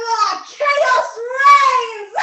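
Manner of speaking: slow, loud, high villain gloat then cackle; evil cackle. Acted. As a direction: revel in the chaos and cackle.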